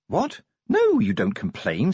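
sharp, high-pitched retort; snapped. Acted. Sergeant setting new recruit straight, snapped. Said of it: "snapped Angua".

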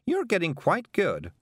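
high-pitched praise; applauding witch. A narrator's reading. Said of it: "clapping with all four hands".

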